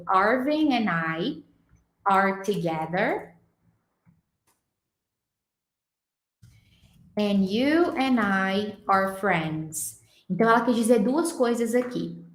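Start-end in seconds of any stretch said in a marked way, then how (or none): 0.0-10.0 sentence read slowly and carefully; slow dictation-style reading; slow and clear. Shown as a demonstration. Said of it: "a frase que ela falou foi".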